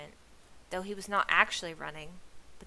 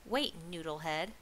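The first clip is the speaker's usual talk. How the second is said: crying out. A narrator's reading. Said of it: "cried Trog".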